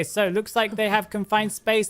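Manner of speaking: ordinary talk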